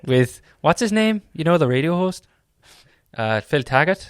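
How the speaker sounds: rising Northern Irish impression; Northern Irish accent. Shown as a demonstration. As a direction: chat away in a Northern Irish lilt. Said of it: "This is more Northern Irish".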